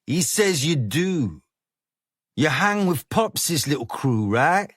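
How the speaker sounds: a lightly voiced street boss, easy and sure of himself; a hint of a gang boss luring a boy into his car; smooth gang boss. A narrator's reading. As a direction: play the calm London hard man, coaxing. Narrator voices Business.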